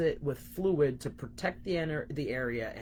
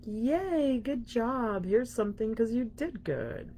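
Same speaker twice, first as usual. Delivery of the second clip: cheery universe voice; 6 semitones higher, slower than usual. Retold or imitated. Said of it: "Then the universe is like".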